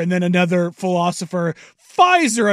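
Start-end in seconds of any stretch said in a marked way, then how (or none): 2.0-2.5 loud announcer voice. Retold or imitated. Mocking ad announcer.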